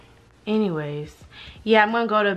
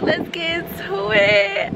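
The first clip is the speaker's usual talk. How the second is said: "Let's get into it." drawn out higher with a rising end; higher, slow sing-song; 7 semitones higher, slower than usual. Shown as a demonstration. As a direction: stretch "Let's get into it." up into a tease.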